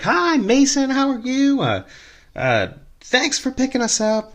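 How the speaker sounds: put-on high, bubbly drunk passenger voice; drunk woman's voice. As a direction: play the tipsy chatty wife, high up. Drunk passenger greets driver.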